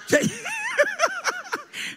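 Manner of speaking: high squealing laugh breaking out. Real, not acted. Pastor laughing at his friend from the pulpit, high squealing laugh. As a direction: burst into a high helpless laugh.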